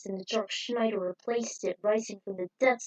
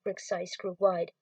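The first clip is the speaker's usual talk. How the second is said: usual speaking voice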